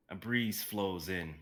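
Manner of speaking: normal talking voice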